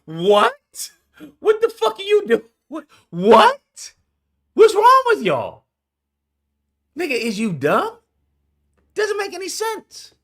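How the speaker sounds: high, loud shocked whats; incredulous outburst. Real, not acted. Reacting to the clip, loud high disbelieving yells. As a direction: yell it in disbelief.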